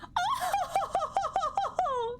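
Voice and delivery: high, long "oh-ho-ho" laugh; ojou-sama laugh. Pretend. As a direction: laugh in a haughty, stagey ripple. Mock posh laugh after accent.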